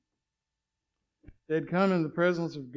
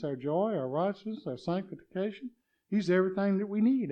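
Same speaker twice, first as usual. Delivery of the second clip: normal talking voice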